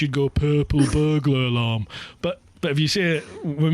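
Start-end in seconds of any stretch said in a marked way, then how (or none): 0.3-1.9 PURPLE BURGLAR ALARM run together in an English accent, no rolled R's. Shown as a demonstration. Scot showing how the English would say it, English accent. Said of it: "if you were English".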